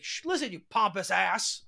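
half-voiced retold yell; mock shout. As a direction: act out a bellow without the volume. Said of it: "and just, like, shouts it".

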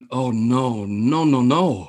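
low mock dismay; Downey. Storyteller replaying the colleague's reaction, mock dread. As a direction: imitate sinking dread, voice dropping. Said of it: "I can just see Downey going".

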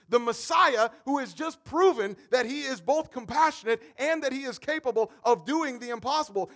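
loud, rising sermon delivery; preaching at full force. Acted. Pastor to his church, preaching loudly at full force. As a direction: drive the story home to the congregation.

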